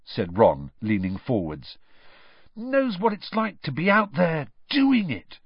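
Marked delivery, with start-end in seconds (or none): 2.6-5.4 high, weighty tone, stress on doing; impressively. Acted. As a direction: make it sound deeply impressive. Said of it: "said George impressively".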